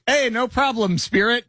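high-pitched, breezy imitation of a helper; chirpy ghost helper. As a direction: toss back a high, easygoing reply. Imitates man answering ghost.

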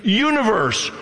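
"Universe." said high and ringing as a proclamation; God's command. Retold or imitated. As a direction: call "Universe." out like God speaking creation into being. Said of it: "All he's gotta do is say".

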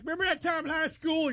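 hammed-up classmate calling out; mock old friend. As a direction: ham up an overexcited old classmate. Mocking old friends' reunion greeting.

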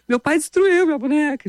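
a light imitation of a wailing little girl; imitated crying child. Daughter complaining about her broken doll, mock crying.

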